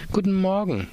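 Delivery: the boss tossing back a chirpy hello; director's voice. A narrator's reading. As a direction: director greeting a sweaty visitor, hammed up high. Director answers greeting.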